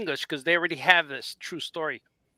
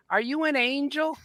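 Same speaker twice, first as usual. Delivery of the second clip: Anakin's voice; 8 semitones higher than usual. Retold or imitated. Author quoting a film line, imitating the voice. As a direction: imitate a wide-eyed line in a high voice. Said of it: "he even said it with the voice".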